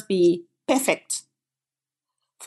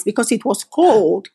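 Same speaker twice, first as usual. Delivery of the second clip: ordinary talk, nothing marked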